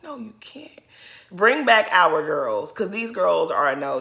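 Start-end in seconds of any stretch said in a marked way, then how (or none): 0.0-0.8 breathless whispered put-down; whispered retort. Real, not acted. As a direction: whisper the no-you-can't.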